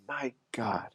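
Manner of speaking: a quiet, lightly voiced imitation of his father; imitating dad. Son doing his late father's exasperated line, lightly voiced. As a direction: mimic dad's sigh of mock despair, softly. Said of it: "I'm doing it from his voice".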